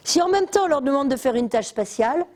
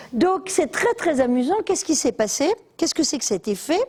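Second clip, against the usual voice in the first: normal talking voice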